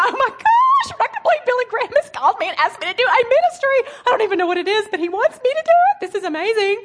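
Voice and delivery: high, fast, gushing and laughing; giddy excitement. Retold or imitated. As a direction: squeal the news to your friends breathlessly. Excitedly calling friends, laughing.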